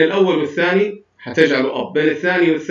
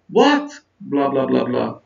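"what" pitched up, the following blahs sinking low; high-to-low intonation. Shown as a demonstration. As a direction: start high on "what", then drop through "بلا بلا بلا بلا". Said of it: "كان high بعدين يبدأ يصير low".

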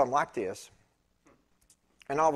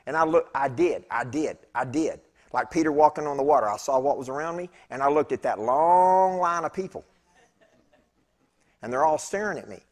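ordinary talk, nothing marked

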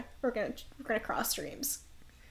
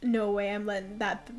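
put-on Brooklyn accent; 3 dB louder than usual. Zeph vowing revenge on the viper, in a Brooklyn accent. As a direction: swear to save her, Brooklyn tough-guy style. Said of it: "Why did I give him a Brooklyn accent?".

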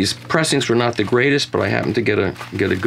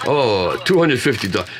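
put-on Japanese accent at normal pitch. Correcting his impression of a record shop clerk, mock Japanese accent. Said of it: "The right accent is".

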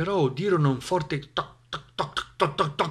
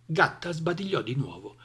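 ordinary delivery, nothing marked

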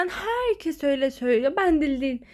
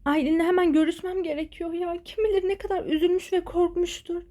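ordinary talk

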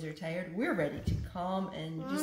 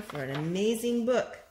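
plain reading voice

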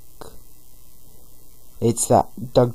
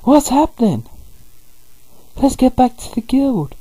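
squeaky cartoon voice; 14 semitones higher than usual.

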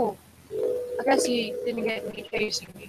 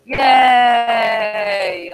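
cheer; 7 dB louder than usual. Real, not acted. Cheering success.

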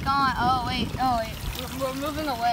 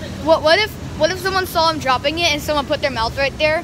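startled; 4 semitones higher, 7 dB louder than usual. Real, not acted. Startled by dropped item.

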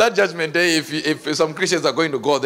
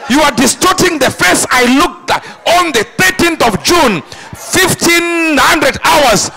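loud, high indignant God; God scolding the cosmetics users; God's complaint; 10 semitones higher, 5 dB louder, slower than usual. Acted. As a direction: protest like an offended creator. God complaining about distortion.

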